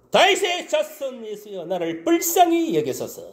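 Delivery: high, slow pleading cry; desperate plea. Retold or imitated. Preacher voicing the blind beggar begging Jesus, slow high plea. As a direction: beg like a blind man calling out. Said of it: "이렇게 간절하게 외친 거예요".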